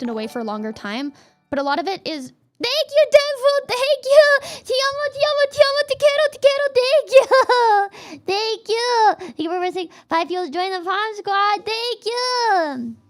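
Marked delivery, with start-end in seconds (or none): 2.6-13.0 high, loud, delighted squeals of thanks; excited squealing thanks. Real, not acted. Streamer thanking a donor, squealing high and loud. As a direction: gush thanks in a high shriek.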